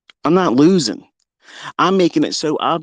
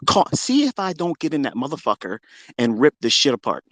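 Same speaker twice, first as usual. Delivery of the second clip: normal talking voice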